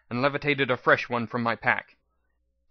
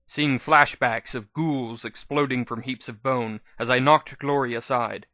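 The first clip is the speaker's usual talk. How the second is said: ordinary delivery, nothing marked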